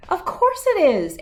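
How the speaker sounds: put-on high, confident child; little girl voice. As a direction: sure of herself, brushing off mom. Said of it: "Abigail said".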